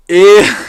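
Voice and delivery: loud, high squeal of mock disgust; cringing squeal. Mocking reaction to coworkers' slang.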